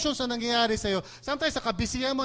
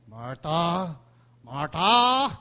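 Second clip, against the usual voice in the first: rising to a loud rebuke; sharp scolding call; 4 semitones lower, 5 dB louder, much slower than usual. Shown as a demonstration. Jesus rebuking Martha, sharp and loud. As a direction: scold her name, getting louder. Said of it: "Hindi natin alam kung anong tono noon".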